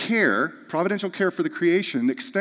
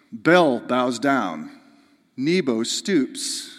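slow solemn reading; 2 semitones lower, much slower than usual. Acted.

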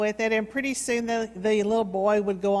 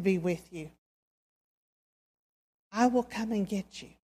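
tender reassurance. A narrator's reading. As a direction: hold him close with your voice. Father comforts rescued son.

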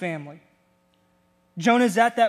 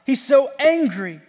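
heated emphasis; 5 semitones higher, 5 dB louder than usual. Real, not acted. Preacher echoing Jonah's anger.

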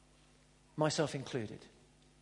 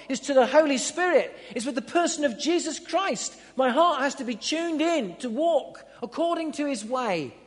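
loud, high, fervent preaching; 6 semitones higher, 6 dB louder than usual. Acted. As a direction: rouse the congregation, building up. Preaching with rising force.

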